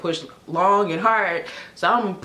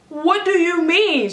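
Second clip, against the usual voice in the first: high, drawn-out retold shock; startled disbelief; 9 semitones higher, 4 dB louder, slower than usual. Mother startled at being told to push, in disbelief. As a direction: act out being caught totally off guard.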